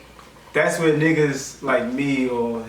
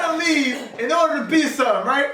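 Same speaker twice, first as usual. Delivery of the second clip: high-pitched, emphatic hype; fired up and pitched high; real impassioned; 11 semitones higher than usual. Real, not acted. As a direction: drive the point home with rising excitement. Impassioned answer over the banter.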